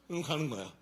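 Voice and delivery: ordinary talk, nothing marked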